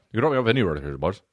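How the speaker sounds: put-on low, mumbled Irish accent; unclear Irish accent. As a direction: slur it in an imitated Irish accent. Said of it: "wasn't even, like, as clear as I said it".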